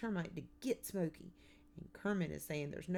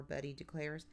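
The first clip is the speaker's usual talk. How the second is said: ordinary delivery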